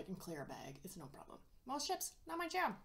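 biting a crunchy snack; talking while eating a snack. Real, not acted. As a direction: mouth partly full. Seen 1: Holds a cheezie for 0-6 s, then bites it from 6.9 s.

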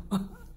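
short high laugh. Real, not acted. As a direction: laugh it off in disbelief. Laugh alone.

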